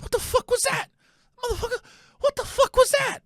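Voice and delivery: very high mock disbelief; stunned dad. Son imitating dad staring ahead at the wheel, high-pitched shock. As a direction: repeat it high-pitched, hammed-up and dazed. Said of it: "he's just driving, looking straight ahead".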